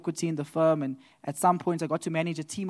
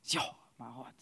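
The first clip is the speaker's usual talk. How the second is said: much lower, much softer dismay; deflated gasp; 6 semitones lower, 12 dB quieter than usual. Retold or imitated. Hearing he wasn't made salary partner, crushed. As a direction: let the heart sink.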